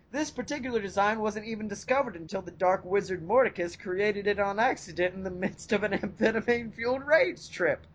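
ordinary talk

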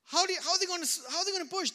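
high incredulous questioning; lightly voiced skeptical self; doubting mind. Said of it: "fleshly mind says".

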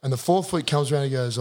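ordinary talk

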